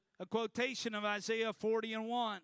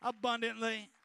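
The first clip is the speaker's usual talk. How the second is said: higher-pitched, driving sermon delivery; full-force preaching; 4 semitones higher than usual. Acted. Preacher to congregation on ordinary people changed by God, full-force preaching. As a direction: preach it with urgent, rising intensity.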